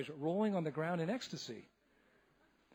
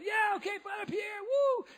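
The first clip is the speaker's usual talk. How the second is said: much higher cheer. Retold or imitated. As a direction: whoop along with him. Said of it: "they said".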